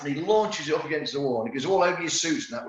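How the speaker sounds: ordinary delivery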